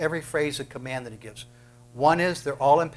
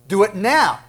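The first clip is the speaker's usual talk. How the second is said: higher and louder, sharp command; shouted order; 6 semitones higher, 10 dB louder than usual. Shown as a demonstration. Preacher ordering the congregation, loud command. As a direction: demand it right now. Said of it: "It's not an option".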